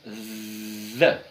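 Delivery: Z held long and buzzing, low; Z prolongado como o th; voiced th demo. Shown as a demonstration. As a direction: stretch the Z sound for students. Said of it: "A gente parece que tá dizendo".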